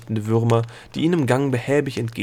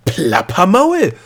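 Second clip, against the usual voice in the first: loud, high, stretched indignation; indignant snap; 8 semitones higher, 9 dB louder, slower than usual. A narrator's reading. Girl snapping back at the gnome, loudly indignant. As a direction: throw the insult back, outraged. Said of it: "schnappte Delia zurück".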